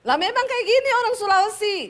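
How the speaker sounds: loud, high-pitched retort; high Sulawesi tone. Retold or imitated. Sulawesi wife defending how she talks, loud high protest. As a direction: fire back at the top of your range. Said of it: "nadamu tuh tinggi".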